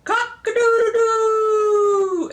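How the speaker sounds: loud, high, drawn-out crow; rooster crow. Retold or imitated. Grandma showing how a rooster goes, crowing loudly. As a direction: crow with a long held final "doo". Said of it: "Rooster goes".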